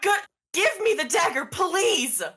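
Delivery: high, loud pleading yell; frantic, forceful begging; desperate shout. Acted. Desperate demand after captive screams.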